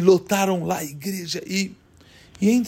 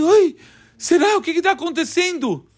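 startled; 9 semitones higher than usual. Acted. Startled by the statue.

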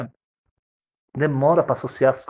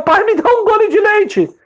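put-on much higher, louder, slow small-child plea; child's voice; 5 dB louder, slower than usual. Baby son begging his father for milk, high whiny child. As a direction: ask like a whiny toddler.

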